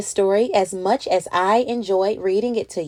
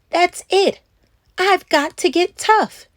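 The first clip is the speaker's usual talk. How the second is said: a touch of fed-up resolve, higher; determined; 7 semitones higher, slower than usual. Acted. A tired girl resolving to get tough with monsters, mildly determined. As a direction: put your foot down, mildly. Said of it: "She decided".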